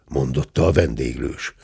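plain reading voice

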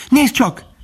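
very high excited little call; izgatott kiáltás. A narrator's reading. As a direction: call out like an amazed youngster. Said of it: "kiáltott Bambi". (magas, izgatott felkiáltás)

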